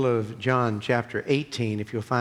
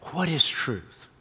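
higher, pointed question put straight to the face; direct, in earnest; 5 semitones higher, slower than usual. Acted. Preacher voicing Pilate confronting Jesus eye to eye, direct and earnest. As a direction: ask it as if looking someone right in the eyes. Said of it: "looked Jesus right in the eyes and say".

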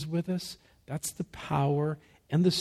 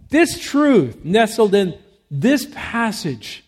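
louder, driving sermon delivery; fervent preaching; 3 semitones higher, 7 dB louder than usual. Real, not acted. Pastor urging the congregation to rejoice, full-force preaching. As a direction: press the congregation to rejoice, building.

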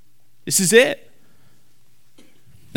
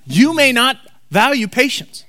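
normal talking voice

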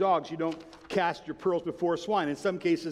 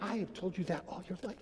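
quiet aside as a parent; much softer, mock-exasperated reply; muted exasperated parent; 10 dB quieter than usual. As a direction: imitate a parent grumbling under the breath. Retold parent's exasperated reply to son.

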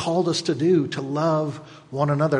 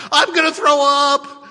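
high, loud squealing complaint; queasy rider; 13 semitones higher, 7 dB louder than usual. Acted. Preacher acting the scared rider, high loud wail. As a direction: wail it like you're about to be sick. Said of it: "the one who is scared".

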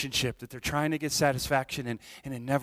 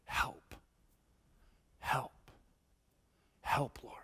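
hushed whisper with long pauses; whispered plea; 2 semitones lower, 10 dB quieter, much slower than usual. Retold or imitated. Pastor voicing a prayer in crisis, whispered. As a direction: breathe out each help as a prayer. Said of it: "and you realize".

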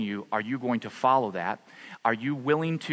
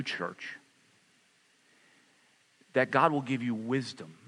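hushed pastoral prayer. Acted. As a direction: pray gently over them, slowing down. Leads closing prayer.

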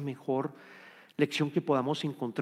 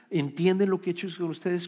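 usual speaking voice

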